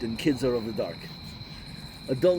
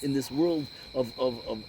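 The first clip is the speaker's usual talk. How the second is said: ordinary delivery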